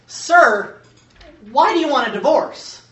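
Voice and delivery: loud, high, fed-up judge finally snaps; the judge cracks and demands a straight answer; exasperated judge. Retold or imitated. Judge finally loses patience.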